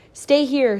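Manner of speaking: put-on mother ordering the children loudly to stay; ordered. A narrator's reading. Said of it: "she ordered".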